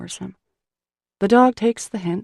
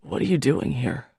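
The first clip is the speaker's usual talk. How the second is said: lower, rough exhausted man's voice; exhausted fisherman, hoarse and unwelcoming, lower; scratchy and hoarse; 6 semitones lower than usual. Acted. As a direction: rasp it out, worn to the bone. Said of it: "scratchy and hoarse".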